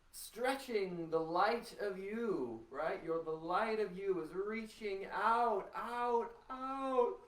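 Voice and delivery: stretching voice outward. Shown as a demonstration. Teacher urging the class to stretch their light outward, voice climbing. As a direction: send the voice out like rays of light. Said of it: "as you yawn and stretch".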